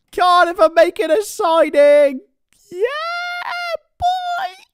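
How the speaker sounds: high, loud, slowed shout of joy at the news; excited shout. Real, not acted. Streamer shouting to his viewers about a Cardiff signing, overjoyed. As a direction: yell it out, thrilled by the signing.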